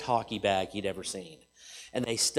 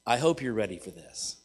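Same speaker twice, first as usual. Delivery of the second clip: normal talking voice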